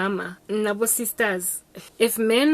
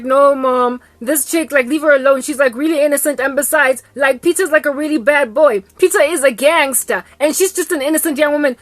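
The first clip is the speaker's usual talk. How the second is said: a hint of the son's loud pleading; lightly voiced protesting son; 5 semitones higher, 8 dB louder than usual. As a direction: plead with mom a bit louder. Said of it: "Vazi's like".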